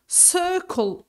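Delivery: high CIR, falling away in "Circle"; first-syllable stress. Shown as a demonstration. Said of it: "the stress falls on the first syllable".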